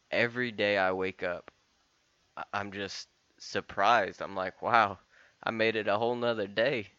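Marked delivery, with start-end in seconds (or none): none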